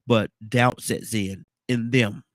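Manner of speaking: slow emphasis. Real, not acted. Preaching emphasis, slowed.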